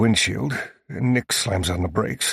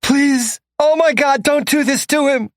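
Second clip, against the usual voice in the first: much higher, loud, frantic scream; screaming plea; 6 dB louder than usual. A narrator's reading. Bridget screaming at the captors, frantic high plea. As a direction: scream for them to stop. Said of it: "Bridget starts yelling".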